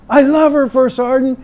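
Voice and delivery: high, loud lovesick plea; pleading lance corporal. Retold or imitated. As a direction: beg the sergeant like a lovestruck kid. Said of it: "the conversation went something like this".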